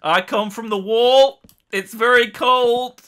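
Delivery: very loud, slow, solemn proclamation; Jon Snow. Retold or imitated. Told to make conversation, answers in loud solemn declamation. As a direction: declaim it high, stretching every word. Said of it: "And he's like".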